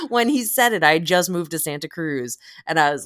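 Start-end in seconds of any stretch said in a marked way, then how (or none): none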